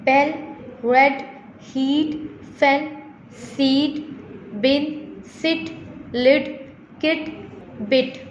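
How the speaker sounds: "Bell, red, heat" onward, each word alone with a pause, vowel clearly sounded; vowel read-aloud. Shown as a demonstration. Teacher reading practice words to students, "lid, kit, bit" separately enunciated for the vowel. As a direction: read "seat, bin, sit" as isolated model words, long or short vowel distinct. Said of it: "read aloud long and short vowel sound".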